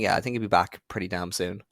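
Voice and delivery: usual speaking voice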